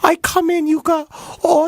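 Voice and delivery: high broken-English maid voice; hammed-up foreign maid, slow; maid accent. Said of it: "to be made is its own accent".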